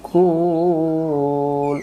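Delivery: very slow, drawn-out tajweed chant; slow melodic Quranic recitation. Shown as a demonstration. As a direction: recite the verse, stretching every vowel. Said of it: "সূরাতুল ফিলের শেষের আয়াতে আছে".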